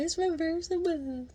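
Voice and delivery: very high, drawn-out fake wail; mock crying. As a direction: imitate a woman protesting through tears. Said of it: "She's still crying".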